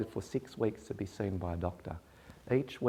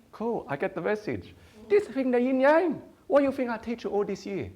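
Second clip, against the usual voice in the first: proud father, louder and higher, rubbing it in; mock Chinese dad; 14 semitones higher, 9 dB louder than usual. Father voiced in quarrel.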